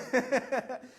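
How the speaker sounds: short, real chuckle; amused laugh. Real, not acted. Guitar tech joking about Danilo's signature model, amused laugh. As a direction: chuckle at your own little joke.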